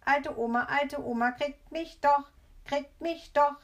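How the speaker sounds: high, loud playground chant; bratty, loud high-pitched chanting; taunting call. A narrator's reading. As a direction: call out the tease in a child's sing-song. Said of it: "und ruft".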